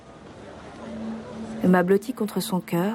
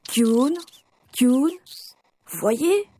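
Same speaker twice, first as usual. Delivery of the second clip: put-on high, rising calls to a baby; calling out; 5 semitones higher, much slower than usual. Parents testing baby's hearing at the doctor's, calling out. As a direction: call the name questioningly, pitch climbing.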